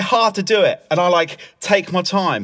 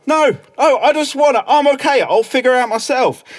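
higher, pestered protest; exasperated refusal; 6 semitones higher than usual. Retold or imitated. [name] telling the meddlers he's okay, exasperated. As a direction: fend them off in exasperation. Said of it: "I go".

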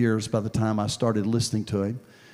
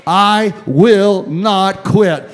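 slow, loud, higher, each word hammered; strong resolve; 8 semitones higher, 13 dB louder, much slower than usual. Retold or imitated. Inmate finding strength, loud slow declaration. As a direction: declare it like a vow nobody can break. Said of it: "strength began to come to his voice".

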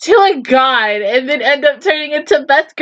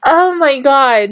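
usual speaking voice

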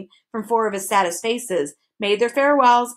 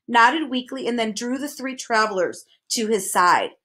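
plain reading voice

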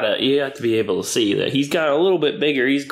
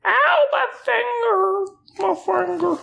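high, slow whiny ow; mock hurt baby voice; slower than usual. Owner playfully complaining the puppy bit her finger, high mock whine. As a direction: whimper it like a hurt toddler, playfully.

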